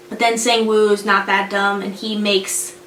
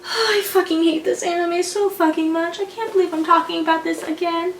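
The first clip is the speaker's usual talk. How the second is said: higher-pitched weary complaint after a sigh; sigh, then moan in exasperation; exasperated rant; 6 semitones higher than usual. Real, not acted. Host regretting this anime recap, exasperated.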